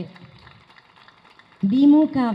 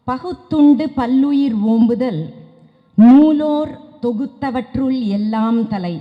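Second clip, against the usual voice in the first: poem recitation; 3 semitones higher, 7 dB louder than usual. Acted. Reciting a Thirukkural couplet.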